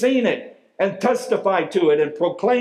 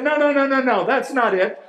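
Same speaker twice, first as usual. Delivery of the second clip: excited denial; 7 semitones higher, faster than usual. Retold or imitated. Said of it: "And the guy said".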